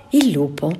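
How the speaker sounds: usual speaking voice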